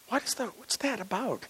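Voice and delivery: hushed high-pitched disbelief; puzzled scoffing. Acted. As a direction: question it quietly, high and incredulous. Preacher mocks a token devotional.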